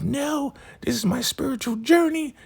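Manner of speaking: high mock-earnest refusal; high Laura voice. Laura refusing Aaron, high mock-earnest voice. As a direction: squeak it high, clinging to the dream. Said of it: "She's like".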